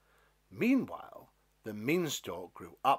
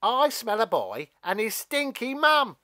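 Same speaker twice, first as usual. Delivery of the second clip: loud taunt; 11 semitones higher, 11 dB louder than usual. Acted. Stalk taunts Jack and mum.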